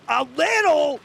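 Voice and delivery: high, loud disbelief; outraged at the understatement; incredulous. Real, not acted. Streamer scoffs at the narration, high and loud.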